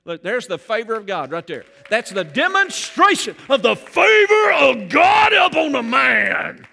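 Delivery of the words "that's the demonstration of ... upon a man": booming shout, much louder and higher; deep manly voice. Pretend. Pastor showing off his wife to the congregation, booming shout. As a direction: proclaim it like a big man. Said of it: "my voice got deep".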